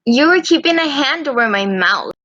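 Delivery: ordinary delivery, nothing marked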